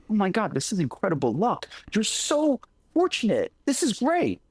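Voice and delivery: high, delighted neighbor voice; gushing neighbor. Retold or imitated. Storyteller voicing envious neighbors, high and gushing. Neighbors gush over good luck.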